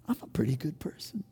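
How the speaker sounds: whispered, quick self-congratulation; smug whisper. Retold or imitated. Preacher whispering his old proud thought to the church. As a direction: hush it like a secret boast.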